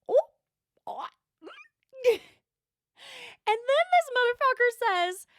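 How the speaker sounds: high, loud outraged squeal; a high, loud, scandalised shriek. Real, not acted. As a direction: shriek it in disbelief. Outraged at ranch hand.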